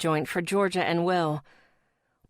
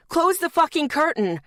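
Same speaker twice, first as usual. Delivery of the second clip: shouting; 9 semitones higher, 4 dB louder than usual. Acted. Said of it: "the man shouted".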